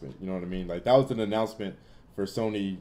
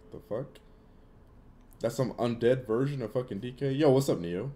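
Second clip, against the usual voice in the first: normal talking voice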